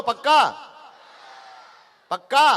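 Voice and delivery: loud rising shout with a laugh; shouted rally call. Real, not acted. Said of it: "जोर से बोलो".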